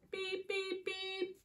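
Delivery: high, soft, even beeps; truck horn beeps. Acted. Little Blue Truck beeps to friends, high horn imitation. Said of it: "says Little Blue".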